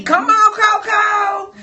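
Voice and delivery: loud, high cheering call to Coco; excited shout. Real, not acted. Cheering on her friend Coco, loud and high.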